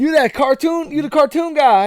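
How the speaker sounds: put-on high, loud, hyped-up young fan; excited fan. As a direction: gush like a starstruck kid. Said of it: "He went".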